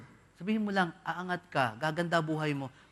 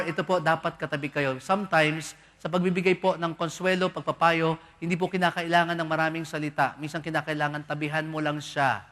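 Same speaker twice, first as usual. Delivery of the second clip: ordinary delivery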